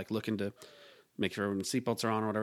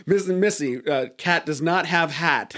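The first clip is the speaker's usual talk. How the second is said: mock broken English; 4 semitones higher, 5 dB louder, slower than usual. Support reply in broken English.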